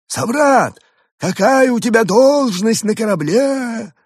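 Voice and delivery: high, slow alien question. A narrator's reading. Alien Evgeny asks. (высокий медленный голос пришельца)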